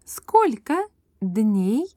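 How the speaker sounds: ordinary delivery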